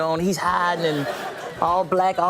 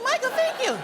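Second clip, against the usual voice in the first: overjoyed thanks. Retold or imitated. Grateful thanks.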